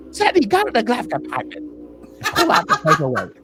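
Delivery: high-pitched mock English accent; Englishman accent. Driver sends his passenger for paperwork in a put-on English accent. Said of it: "He said it like an Englishman".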